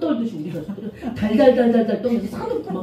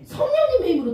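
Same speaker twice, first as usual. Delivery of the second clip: 성령님의 힘으로 called out much higher and loud; emphatic answer; 12 semitones higher, 3 dB louder than usual. Shown as a demonstration. Pastor answering the children, proclaiming it high and loud. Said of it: "누구의 힘으로?".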